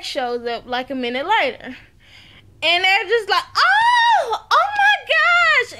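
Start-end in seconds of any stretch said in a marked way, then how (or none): 3.6-5.8 high, loud, drawn-out squeal; mock shocked shriek. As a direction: squeal in hammed-up astonishment. Acting out their shocked greeting.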